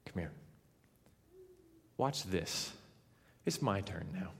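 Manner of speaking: low, hushed summons; quiet assurance. Acted. Elijah telling people it's his turn, quietly assured. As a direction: draw them in softly, sure of yourself.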